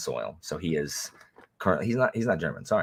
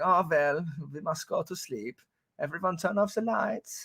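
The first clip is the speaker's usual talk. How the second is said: higher mock German accent, formal and stiff; 10 semitones higher, 3 dB quieter than usual. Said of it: "I think Nano's German, so it's like".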